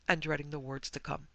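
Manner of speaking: ordinary delivery, nothing marked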